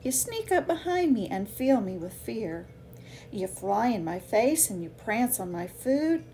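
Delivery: gentle, lively picture-book read-aloud; reading to children. Acted. Author reading her bee book to kids, gentle and lively.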